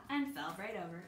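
ordinary delivery

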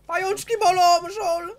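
squeaky high shriek of fake pain; shrill squeal. High shrieked game joke.

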